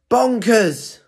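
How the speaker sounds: high, loud outburst; penguin's exclamation. Acted. Penguin's final word in the story, loud exclamation. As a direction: burst out with it, then drop. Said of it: "is he gonna be grumpy again?".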